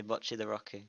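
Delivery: "Bocce the Roku" with short o and added end vowel; Japanese title pronunciation. Retold or imitated. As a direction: softly quote "Roku" Japanese-style. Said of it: "when they say".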